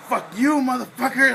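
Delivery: high, loud mock-furious yell; retold angry outburst. Man retelling a concert argument, loud angry outburst. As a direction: act out someone snapping, voice shooting up loud. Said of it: "something snapped on me".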